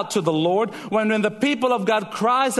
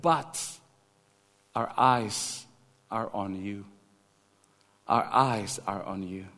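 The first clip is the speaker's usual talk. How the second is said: much softer and lower, a whispered trust; hushed prayer; 11 semitones lower, 7 dB quieter than usual. Acted. King praying to the Lord, hushed and lower. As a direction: drop to a near-hush of surrender.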